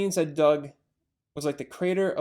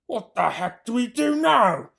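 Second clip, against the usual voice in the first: frustrated farmer; 6 semitones higher, 5 dB louder than usual. Acted. Bogus frustrated.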